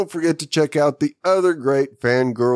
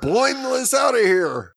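shouting sign-off; 10 semitones higher than usual. Acted. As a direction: yell it out.

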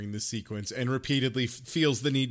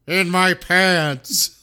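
slow, loud, stretched-out delivery; mock punchline; 3 semitones higher, 5 dB louder, much slower than usual. Mocking the punchline after an awful silence, loud and slow. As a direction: drop the lame gag loudly, word by word.

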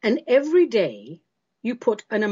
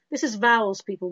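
ordinary talk

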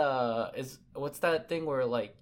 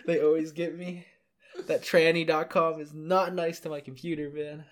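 laughing; 5 semitones higher, 4 dB louder than usual. Real, not acted. Joking back while laughing.

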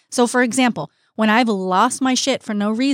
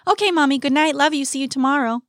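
high, light imitation of a child; sing-out bedtime goodbye from a kid; child's good night; 4 semitones higher than usual.

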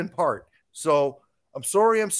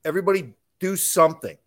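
ordinary talk, nothing marked

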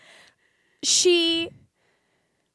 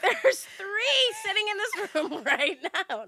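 laughing; 7 semitones higher than usual. Real, not acted. Laughing while teasing partner.

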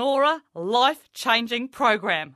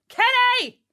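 much higher, loud shout; calling out; 13 semitones higher, 8 dB louder than usual. Acted. Coach calling shy cousin Kenny, a loud high shout. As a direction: call Kenny across the room.

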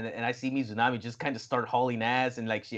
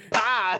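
short, loud "bah!"; high, sudden mock battle cry; imitated strike shout; 10 semitones higher, 6 dB louder than usual. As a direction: burst it out like a sudden punch. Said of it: "He's like".

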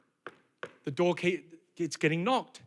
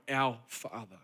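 slow, much softer and lower prayer; reverent prayer; 11 semitones lower, 10 dB quieter, slower than usual. Acted. Preacher reciting the Lord's Prayer to the church, soft and slow.

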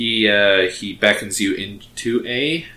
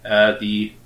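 ordinary talk

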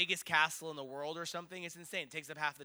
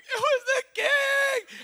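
imitated sobbing shriek, drawn out slowly; crying scream; 13 dB louder, much slower than usual. Crowd in tears after seeing the king, mock crying scream. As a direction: wail it high and loud like an overwhelmed fan. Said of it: "people are crying".

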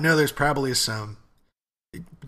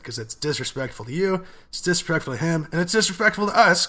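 each "disrespectful" higher, ending loud on "us"; building emphasis; 5 semitones higher, 4 dB louder than usual. Real, not acted. Podcaster listing who is wronged, building higher and louder to "us". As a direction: climb step by step through "you", "him", "us".

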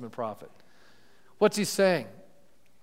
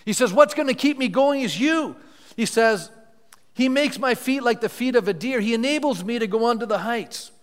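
higher, louder proclamation building to triumph; fervent scripture preaching; 7 semitones higher, 6 dB louder than usual. Acted. Pastor declaring Habakkuk's faith to the church, triumphant preaching.

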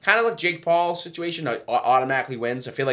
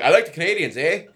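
put-on rough stab at a Canadian accent, pitched up; 3 semitones higher than usual. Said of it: "your attempt at a Canadian accent".

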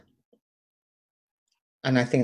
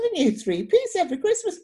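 knowing tease. Retold or imitated. Repeating his giveaway line.

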